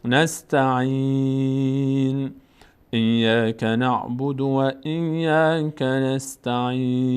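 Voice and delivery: slow, sustained tajweed chant; Quran recitation. Shown as a demonstration. Teacher models the verse for learners, slow measured recitation. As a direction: recite slowly, drawing out the long vowels.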